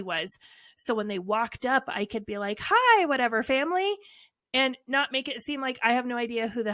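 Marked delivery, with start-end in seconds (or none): 2.6-3.9 higher, louder, bright mock welcome; fake cheery greeting. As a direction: greet them like you know them. Faking a friendly greeting.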